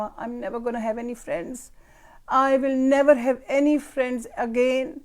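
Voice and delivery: put-on slow, drawn-out wail of a five-year-old; mock tragic child, stretched very slowly; dramatic child. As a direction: ham up a child's melodrama. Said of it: "پورا اس نے drama create کیا".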